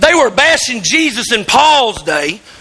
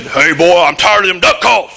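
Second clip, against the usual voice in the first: lower, mock spooky ghost voice; 7 semitones lower than usual. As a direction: sneak up and spook the boy with a lower voice. Dad in a sheet scaring son.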